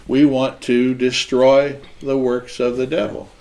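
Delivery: usual speaking voice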